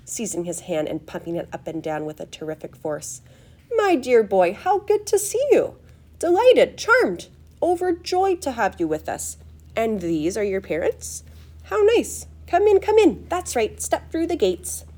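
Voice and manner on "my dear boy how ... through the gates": high, fluty, gushing host; over-the-top delighted greeting; excited, effusive welcome. A narrator's reading. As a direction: gush with delight, voice leaping high. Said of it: "Mister Wonka was clearly just as excited".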